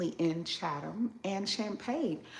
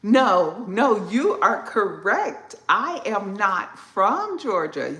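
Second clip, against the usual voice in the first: loud emphatic answer; 9 dB louder, slower than usual. Real, not acted. Stressed answer to a viewer's comment.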